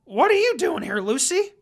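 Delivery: put-on high, loud startled boy's voice; Naruto. A narrator's reading. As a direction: blurt it out, caught off guard at the door. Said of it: "He asked".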